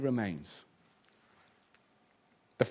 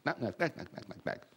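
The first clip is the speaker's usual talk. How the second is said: whispered knocking; 3 semitones lower, 9 dB quieter than usual. Retold or imitated. Knocking imitated.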